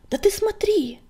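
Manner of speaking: high, loud excited urging; acted alarmed exclamation; urgent insistence. A narrator's reading. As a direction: urge a friend to look right now. Urgent call to look up.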